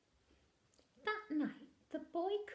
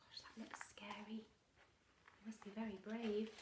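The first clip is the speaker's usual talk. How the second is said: whisper; 2 semitones lower, 9 dB quieter than usual. Acted. Reader's aside to listening children.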